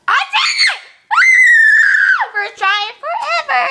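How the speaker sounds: laughing high shriek of triumph; thrilled shout. Real, not acted. Celebrating her burp success, laughing shout. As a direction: scream with joy at your win. Triumph after burping the word.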